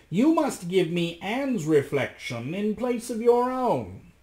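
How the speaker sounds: high, drawn-out magician's voice. A narrator's reading. Magician demands Anne's reflection, high and commanding. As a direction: lay down terms in a high voice. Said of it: "said the magician".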